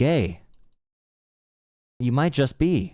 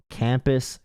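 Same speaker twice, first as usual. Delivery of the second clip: usual speaking voice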